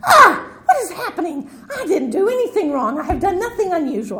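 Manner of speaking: hammed-up flustered wail; mock panic. Teacher showing how she'd usually panic at the computer. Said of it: "normally I would be like".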